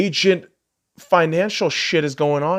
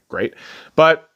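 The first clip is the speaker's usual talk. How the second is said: ordinary talk, nothing marked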